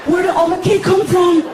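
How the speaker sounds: high, loud put-on voice; Frankie. Woken Frankie walking into the kitchen, high loud question. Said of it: "Frankie walks into the kitchen".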